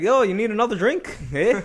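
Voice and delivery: high-pitched, amused Canadian impression; mock Canadian accent. Canadian to drink spiller, mock Canadian accent. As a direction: play up the Canadian "eh". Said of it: "trying to sound Canadian".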